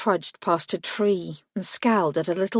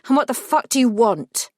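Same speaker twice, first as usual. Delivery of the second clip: angry; 5 semitones higher, 5 dB louder than usual. Acted. Irritated follow-up demand.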